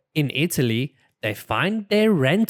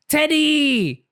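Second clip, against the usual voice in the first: high delighted shout of the name; high happy squeal; joyful cry; 14 semitones higher, 3 dB louder than usual. Acted. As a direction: cry out with joy at the found bear. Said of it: "cries Peppa".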